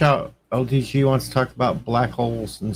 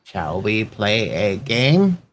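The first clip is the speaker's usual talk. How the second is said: slow movie computer; 4 semitones lower, much slower than usual. Acted. Quoting WarGames computer.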